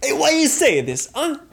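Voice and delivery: high, loud Italian American protest; hammed-up Italian American challenge; Italian American accent. Performer challenging listener, loud high Italian American accent. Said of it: "I know how to do it".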